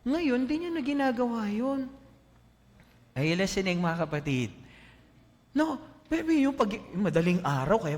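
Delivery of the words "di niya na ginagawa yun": ordinary talk, nothing marked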